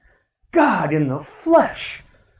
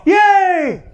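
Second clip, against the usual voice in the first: yelled yay; 10 semitones higher than usual. Shown as a demonstration. Pastor yelling a greeting from the corner of the church. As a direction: shout it from the back corner. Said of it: "I just yell".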